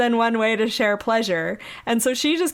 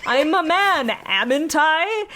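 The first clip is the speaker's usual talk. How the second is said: high, hammed-up Scottish brogue; cartoonish Scottish accent; 8 semitones higher, 4 dB louder, slower than usual. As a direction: parody a Scottish hero, pitched up. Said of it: "cartoonish version of the Scottish accent".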